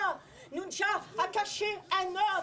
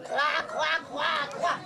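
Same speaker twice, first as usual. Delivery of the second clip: frog croak; 5 semitones lower, much slower than usual. Acted.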